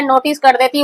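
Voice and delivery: normal talking voice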